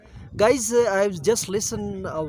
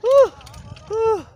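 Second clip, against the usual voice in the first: very high, loud yelps of surprise; startled cries; 11 dB louder, slower than usual. Real, not acted. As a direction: yelp out in shock.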